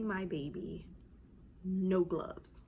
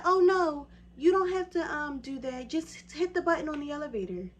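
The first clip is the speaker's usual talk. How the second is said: high, loud, breezy brush-off; pitched way up, waving her off; dismissive receptionist; 8 semitones higher, 6 dB louder than usual. Retold or imitated. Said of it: "She said".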